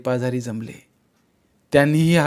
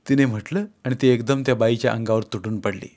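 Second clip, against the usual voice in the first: plain reading voice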